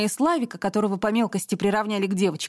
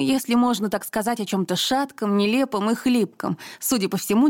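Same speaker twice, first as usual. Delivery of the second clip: ordinary delivery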